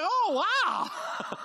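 high, loud amazed gasp of words; retold delight at being recognized. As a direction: sound thrilled and floored. Retelling amazed reaction.

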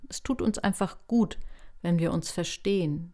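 ordinary talk, nothing marked

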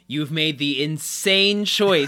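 lightly voiced frustrated Chidi; flustered Chidi. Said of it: "he's like".

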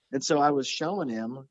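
usual speaking voice

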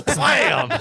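loud high sound effect; shouted blam. Retold or imitated. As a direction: yell blam like a slam. Sound effect after quote.

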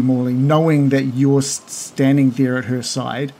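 normal talking voice

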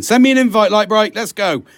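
excited invite. Real, not acted. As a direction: shout the invite through bursts of laughter. Laughing invite to a Texas listener.

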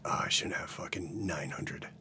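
very quiet, nearly whispered regret; rueful muttered aside. Retold or imitated. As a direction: mutter it to yourself, low and hushed. Acts out his own rueful realization.

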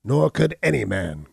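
loud, high outburst; defiant cry. A narrator's reading. Hunchback denying forgery to Abner, loud defiant cry. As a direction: fling the denial back hard. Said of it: "cried the hunchback".

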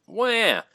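high, drawn-out mock baby wail; exaggerated high crybaby noise; mock complaining wail. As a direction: cry "wah" like a whiner. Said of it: "Complains to the ref".

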